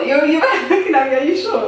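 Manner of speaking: giggling question; laughing. Real, not acted. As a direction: laugh through the words. Laughing while asking.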